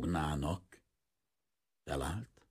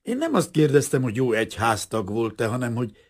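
interrupting; 7 semitones higher, 13 dB louder than usual. Acted. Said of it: "mosolyogva vágott a szavába".

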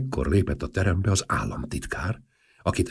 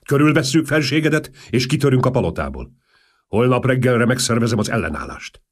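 loud, higher fervent urging; fervent plea; 6 semitones higher, 6 dB louder than usual. Acted. Colonel urges the king to flee, loud fervent urging. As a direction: press the king with soldierly zeal.

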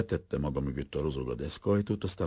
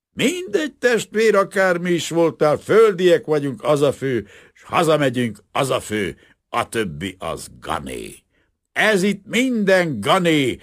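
put-on drunken insistence; 14 semitones higher, 10 dB louder than usual. Drunk carpenter insists on going home.

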